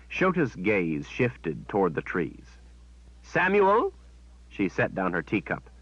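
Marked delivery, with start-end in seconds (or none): 3.2-3.9 high, loud summoning call. Acted. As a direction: call him out of the trees. Shota calls servant from trees.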